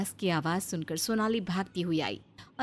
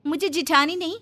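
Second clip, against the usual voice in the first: higher, tattling complaint to mother-in-law; a touch louder, stirring up trouble; scheming elder daughter-in-law; 6 semitones higher, 7 dB louder than usual. Acted. Elder bahu complains to her mother-in-law, higher, tattling.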